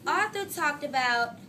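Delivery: usual speaking voice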